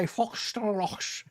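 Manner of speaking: slow, thick-tongued swollen mouth; thick tongue lisp. Shown as a demonstration. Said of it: "when my tongue is swollen and my mouth is out here".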